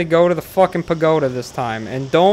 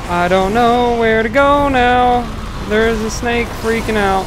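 panicked wail; 5 semitones higher than usual. Real, not acted. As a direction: wail it, lost and under attack. Panicking, lost, snake attacking.

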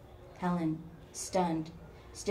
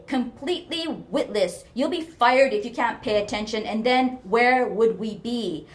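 loud, higher, angry tirade; berating mother; 4 semitones higher, 10 dB louder than usual. A narrator's reading. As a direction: scold hard, hammering each question. Mother berates daughter on phone.

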